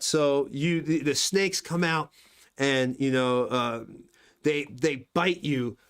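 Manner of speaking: ordinary delivery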